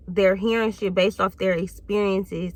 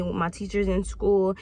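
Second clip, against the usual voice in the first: ordinary talk, nothing marked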